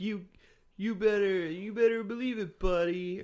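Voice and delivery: high, loud mock kid voice; sitcom kid impression. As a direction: squeal it like a cheeky toddler. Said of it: "she's like".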